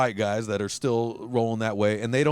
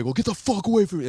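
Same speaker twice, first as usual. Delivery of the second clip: sharp higher snap to back off; louder, higher, quicker get-away warning; mock yell; 9 semitones higher, 4 dB louder, faster than usual. Tattooed guy yelling at the phone crowd to back off, mock yell. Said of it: "they're walking away".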